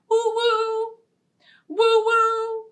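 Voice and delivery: high hooting train whistle woo-woo; playful whistle call. Pretend. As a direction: hoot like a toy train.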